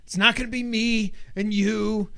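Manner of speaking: high, loud, hammed-up film quote; acted movie outburst. Acts out a film line.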